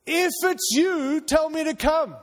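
loud, high, drawn-out call across the water. Acted. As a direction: call out slowly and boldly to the Lord. Acts Peter calling to Jesus.

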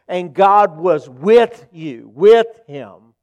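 loud, emphatic sermon delivery; forceful preaching. Real, not acted. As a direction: drive the point home, leaning hard on key words. Preaches the point at full force.

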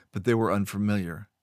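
ordinary delivery

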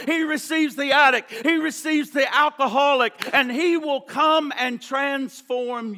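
loud, rising pulpit preaching that hammers each list; preaching at full force. Acted. Pastor preaching hard to the congregation, loud and driving. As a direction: rail against the griping in the church.

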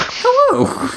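very high squeaky "Hello." with the L swallowed; Homestar Runner hello. Pretend. Friend answering the phone, squeaky cartoon "Hello.". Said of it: "like motherfucking Homestar Runner".

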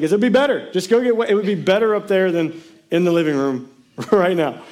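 hammed-up dad insisting; playful imitation of a fed-up father; mock father. Father's voice in joke.

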